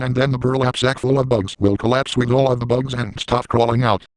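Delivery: normal talking voice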